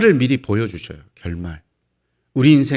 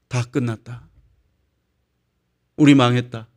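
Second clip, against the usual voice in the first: dejected fan; 5 semitones lower than usual. Acted. Acted fans giving up, losing.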